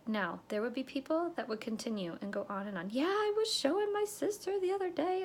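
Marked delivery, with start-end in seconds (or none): 2.9-5.2 high-pitched eager customer; enthusiastic chatterer. Retold or imitated. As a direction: imitate a customer excitedly going on.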